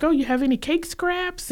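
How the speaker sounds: hopeful in-law angling for leftovers; begging brother-in-law. Retold or imitated. Brother-in-law asking Mel for spare cake, pleading.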